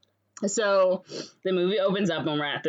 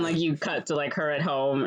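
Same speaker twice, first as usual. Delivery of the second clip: ordinary talk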